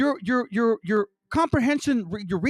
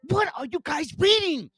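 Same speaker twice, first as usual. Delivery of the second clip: incredulous outburst; 8 semitones higher than usual. Real, not acted. Ranting at misreading viewers.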